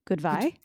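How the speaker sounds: questioning "goodbye" with a v for the b; echoed mispronunciation. Retold or imitated. Co-host querying a slip, "goodbye" as "good-vye?". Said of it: "Is that what I said?".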